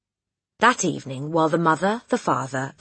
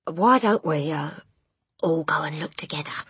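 nervous hesitation. A narrator's reading. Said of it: "wiped his lips nervously".

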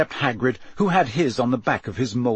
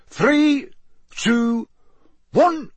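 high, loud, spaced counts; starter's countdown; 12 semitones higher, 4 dB louder, much slower than usual. A narrator's reading. Bagman counts down to his whistle, high announcing voice. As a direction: count them down in a ringing announcer voice.

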